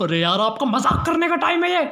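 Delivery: high-pitched exasperated scolding; annoyed. Acted. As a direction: tell off the joker, irritated. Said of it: "आप नाराज क्यों हो रहे". (नाराज़ होकर झिड़कते हुए)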